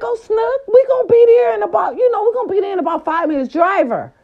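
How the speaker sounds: playing her part. Vlogger replaying her excited answer to Snooki. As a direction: gush like you're thrilled to host her. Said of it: "I was playing my part".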